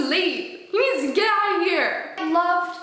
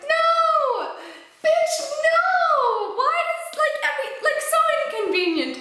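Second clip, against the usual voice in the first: reliving the outrage; 12 semitones higher than usual. Retold or imitated. Reader reliving her shock at the dad getting hurt, shrieking. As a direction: shriek no like the plot betrayed you. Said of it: "I was like".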